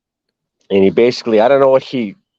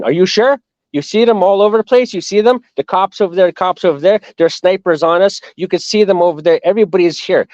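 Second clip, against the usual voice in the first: higher-pitched, urgent worried questioning; retold alarm; 6 semitones higher than usual. Doubting the soldier's promise with cops and snipers around, alarmed. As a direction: imitate someone anxiously pointing out danger all around.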